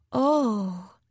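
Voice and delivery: drawn-out, awestruck; breathed in awe. A narrator's reading. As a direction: breathe it out in admiration. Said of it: "she breathed".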